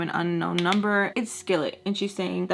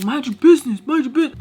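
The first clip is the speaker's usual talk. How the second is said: angry snap; 6 semitones higher, 9 dB louder, faster than usual. Retold or imitated. Mel snapping at bystander.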